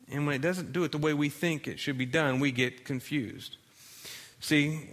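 normal talking voice

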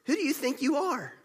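high, sharp, affronted question; indignant challenge. Retold or imitated. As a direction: throw the question back like an insult.

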